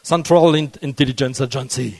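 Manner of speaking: CIA im lockeren amerikanischen Akzent, tiefer und leiser; English-Akzent übertrieben vorführen; mock American accent. Shown as a demonstration. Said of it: "toujours avec mon super accent".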